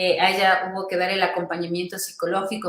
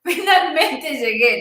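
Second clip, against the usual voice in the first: high, loud, drawn-out cry of arrival; newborn's first cry; 7 semitones higher, 6 dB louder, much slower than usual. Retold or imitated. Newborn announcing its arrival to the room, high loud cry. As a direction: cry out like a baby who has finally made it. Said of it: "pega un grito, pero así como diciendo".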